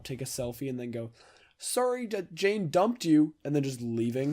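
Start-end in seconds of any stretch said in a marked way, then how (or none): 1.6-3.3 higher, louder mock passer-by, drawn out; random passer-by. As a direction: call it out slowly like an intrusive stranger. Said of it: "random person run up".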